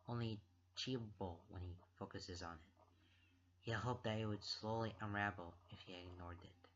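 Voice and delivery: low, soft, subdued reading voice; hushed story narration. A narrator's reading. Reader narrates the anxious knot in his chest, hushed. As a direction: read it softly and low, with tension held in.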